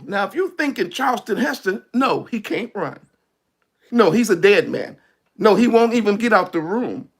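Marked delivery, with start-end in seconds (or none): none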